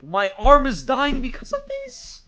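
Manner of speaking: high, loud, slow complaint; loud whine. Real, not acted. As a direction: whine about the aching arm. Complaining while playing.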